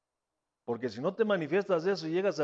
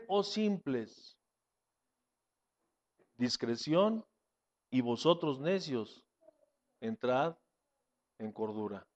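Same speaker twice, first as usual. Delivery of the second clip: fading scripture reading; 8 semitones lower, 7 dB quieter, slower than usual. Acted.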